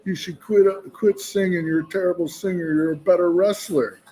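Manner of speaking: loud, high-pitched impaired-speech imitation; imitated impaired speech. Friend in wheelchair heckling the singer, imitated impaired speech. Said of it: "he's got brain damage".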